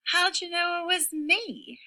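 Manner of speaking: very high howl; howling turkey. Acted. Turkey found out by Rooster, very high howl. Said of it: "howled Turkey".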